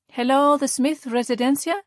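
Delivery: Italian wife answers the phone; Italian accent. A narrator's reading. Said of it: "An Italian-accented woman's voice answered".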